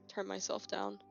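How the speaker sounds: normal talking voice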